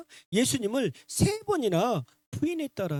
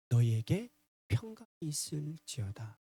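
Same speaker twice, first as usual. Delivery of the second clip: soft, lowered blessing; gentle blessing; 5 semitones lower, 6 dB quieter than usual. Retold or imitated. Risen Lord visiting anxious hearts, gentle blessing. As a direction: comfort anxious hearts quietly. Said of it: "부활하신 주님이 찾아오셔서".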